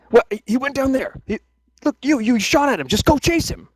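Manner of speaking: high, strained guard voice yelling at a comrade; angry guard. Acted. Guard berating the shooter, high and exasperated. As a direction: bark at the crossbowman like a frustrated guard.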